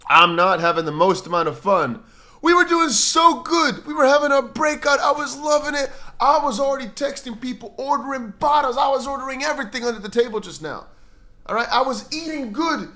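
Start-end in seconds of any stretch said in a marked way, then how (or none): 2.4-12.9 hammed-up whining. Mock-lamenting lost crypto gains.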